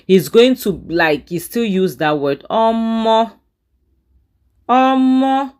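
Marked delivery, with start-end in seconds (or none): 2.5-5.5 high, stunned omo; shocked exclamation. Real, not acted. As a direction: gasp it out in disbelief.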